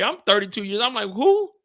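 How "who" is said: much higher, louder startled who; disbelieving who. Retold or imitated. Asked to testify, a high startled who. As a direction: squeak it out in shock. Retold disbelief at being called.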